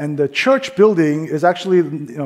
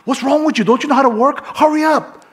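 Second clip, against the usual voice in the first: much higher, louder mock scolding; barking at the cashier; 10 semitones higher, 4 dB louder than usual. Rude woman barking at the cashier to hurry, high angry scolding. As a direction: bark at her like a rude customer. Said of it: "bark at the lady".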